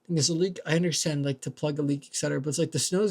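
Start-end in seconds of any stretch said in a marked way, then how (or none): none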